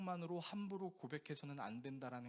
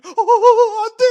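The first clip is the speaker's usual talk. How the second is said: shouted, much higher mock wail; hammed-up anguish; 28 dB louder, slower than usual. Male lead watching the heroine die, mock anguished shout. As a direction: ham up a drama hero's despair. Hero crying over dying heroine.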